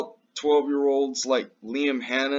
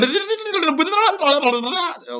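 high mocking laugh; 5 dB louder than usual. Mock laugh at the media.

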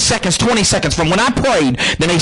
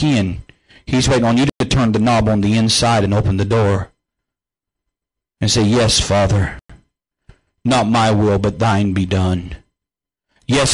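altar call; 7 semitones lower than usual. Acted. Preacher leading viewers to pray for forgiveness, low and hushed. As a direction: draw them gently into prayer.

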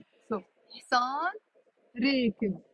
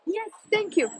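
high, happy thank-you; delighted thanks; 8 semitones higher than usual. Real, not acted. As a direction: thank them gleefully. Thanks viewers for the fix.

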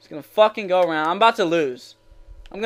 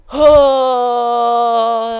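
long, raised wail of defeat; dismayed groan; 4 semitones higher, 6 dB louder than usual. Real, not acted. Gamer groaning after a horrible loss, dismayed.

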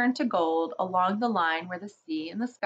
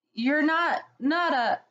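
high, trembling and unsure; falter, too scared to finish; shaky voice; 7 semitones higher than usual. A narrator's reading. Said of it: "asked Susan in a shaky voice".

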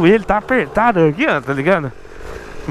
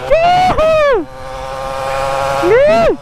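excited whoop; 13 semitones higher, much slower than usual. Real, not acted. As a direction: whoop with joy at speed. Whooping while riding.

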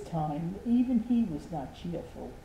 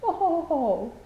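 mournful laugh; 11 semitones higher, 8 dB louder than usual. Acted. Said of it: "she said mournfully".

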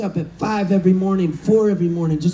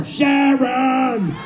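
calling Sharon; 3 semitones higher than usual. Retold or imitated. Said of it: "so you can go".

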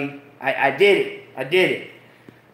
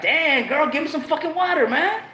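mock plea; 7 semitones higher than usual. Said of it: "And I'm like".

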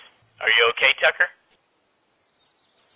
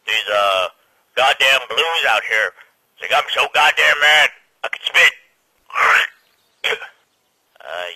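lower-voiced soldier, awkwardly bluffing; put-on lower soldier voice, overdoing the anger; posing as red soldier; 4 semitones lower, 4 dB louder than usual. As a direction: bluff along as one of the reds.

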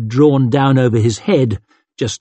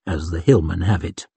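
usual speaking voice

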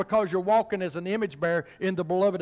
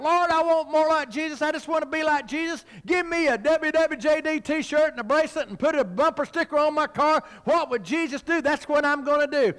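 higher-pitched eager believer's plea; mock crying out; 6 semitones higher than usual. Preacher acting out believers promising to follow Jesus, mock crying out. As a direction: imitate a zealous churchgoer crying to God. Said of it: "he will cry out".

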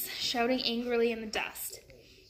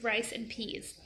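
ordinary delivery

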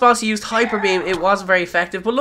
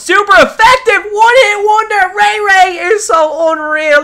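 high, loud cries of disbelief and joy; ecstatic shouting; 11 semitones higher, 8 dB louder than usual. Real, not acted. Gamer celebrating a one-hit knockout, shouting. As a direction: yell like you can't believe the win.

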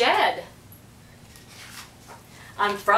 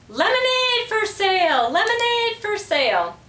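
parrot squawk; 12 semitones higher than usual. A narrator's reading. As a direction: squawk it out like a parrot selling lemonade. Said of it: "Petey squawked again".

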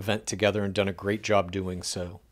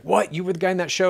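shocked, high-pitched disbelief; surprised fan; 8 semitones higher, faster than usual. Retold or imitated. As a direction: play the stunned fan who just recognized him. Fan realizes who he's talking to.

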